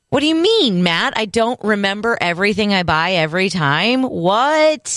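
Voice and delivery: high-pitched, incredulous protest; mock indignation. Real, not acted. Wife challenged by co-host on her bread memory, indignant. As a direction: protest it high, leaping up on "What?".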